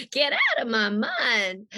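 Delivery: slow Southern drawl, husky; Rogue. Acted. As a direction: draw the line out like a Southern heroine. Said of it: "they wanted a sexy Southern voice".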